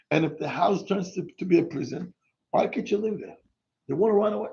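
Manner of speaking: ordinary talk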